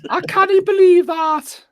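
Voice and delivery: put-on high-pitched Geordie accent; Geordie accent impression. Joking about not understanding Batman's accent, hammed-up Geordie accent. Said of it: "Batman's a Geordie".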